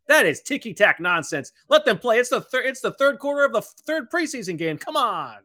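high, loud protest at the referees; exasperated rant. Real, not acted. Color commentator objecting to a holding call, exasperated rant. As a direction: argue the call, voice jumping up in disbelief.

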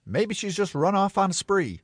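high-pitched hammed-up hopefulness; amateur-dramatic optimism, pitched high; hopeful scene character. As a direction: offer a hope in a high, put-on stage voice. Acts the scene's worried figure.

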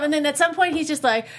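usual speaking voice

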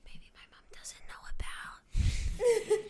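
slow, hushed stage whisper; mock-secret whisper. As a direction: whisper it like a secret kept from mom. Confiding a secret as a joke.

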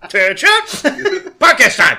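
loud, high bark; drill sergeant. Pretend. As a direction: yell it at the recruits. Mock military podcast start.

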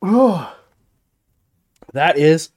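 ordinary talk, nothing marked

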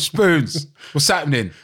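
playful hammed-up Cockney greeting; mock deep Cockney voice. Old mate on the phone greeting him in a mock Cockney voice. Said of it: "really deep and Cockney voice".

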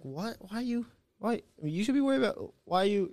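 soft, high-pitched bewildered protest; puzzled disbelief. Retold or imitated. Student questioning his high school counselor, bewildered. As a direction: stammer out a confused objection, retold.

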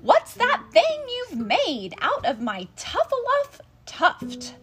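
loud, high, outraged question; upset shouting. A narrator's reading. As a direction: demand an answer, puffing with outrage. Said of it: "he was very upset as he shouted and puffed".

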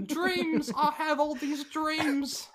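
high, loud, drawn-out mock British; slow it down in a silly high British voice; hammed-up British impression; stupid British accent. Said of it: "they're doing a stupid accent".

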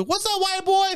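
high mock-flirty call; teasing crowd voice. Crowd greeting Cody, high teasing call. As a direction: tease like a fan in the crowd. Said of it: "They love Cody too".

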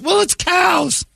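mocking women who find calf birth gross, high slow squeal; mock prissy woman. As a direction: squeal it like a fussy lady. Mock squeamish woman justifying herself.

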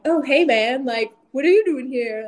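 breezy hey-man greeting; imitating the husband. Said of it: "my husband goes".

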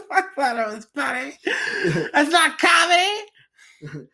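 high squeaky indignant voice, slow on the last line; put-on high prim voice; mock offended voice. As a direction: play a prissy critic sniffing at a joke.

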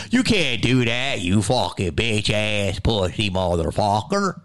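slow, drawn-out Delco put-down; mock Philly heckler with a Delco accent. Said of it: "fucking Delco accent".

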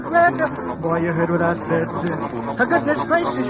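ordinary talk

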